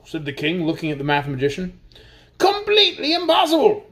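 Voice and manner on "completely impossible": high, emphatic impossible; mathematician's voice. A narrator's reading. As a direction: insist it flatly as the mathematician. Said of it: "said the mathematician".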